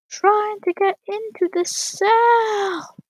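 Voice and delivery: high, slow, drawn-out shout; frustrated shout. Real, not acted. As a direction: stretch it out and yell in frustration. Stuck outside cell, frustration boils over.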